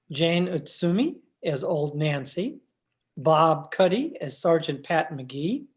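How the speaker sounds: usual speaking voice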